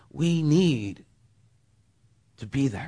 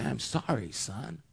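tender apology; 4 semitones higher, 7 dB quieter than usual. Retold or imitated. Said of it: "And I just wanna say".

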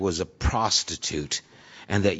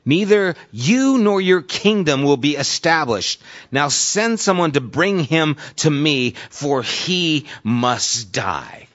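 loud, high, then slowing on must die; rage, then slow down hard at the end; 4 semitones higher, 3 dB louder than usual. Retold or imitated. Saul's angry speech retold.

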